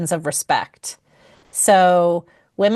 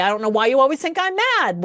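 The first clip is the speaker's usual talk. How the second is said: higher, fast and defensive; 6 semitones higher, faster than usual. Retold or imitated. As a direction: protest that you're not mad, quickly. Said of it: "with the tone".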